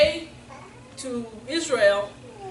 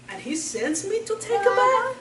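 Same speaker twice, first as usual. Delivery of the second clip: indignant; 7 semitones higher than usual. Acted. General mocking the bath order.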